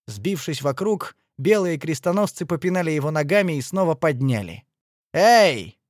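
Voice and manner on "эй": loud, sharp "hey"; raised-voice hail; shouted call. A narrator's reading. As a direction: call out loudly to someone. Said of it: "крикнул один из них".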